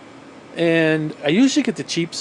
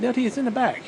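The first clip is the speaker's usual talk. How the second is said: patient instruction; 6 semitones higher, 4 dB quieter, faster than usual. Retold or imitated. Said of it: "I'm like".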